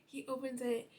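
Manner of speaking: usual speaking voice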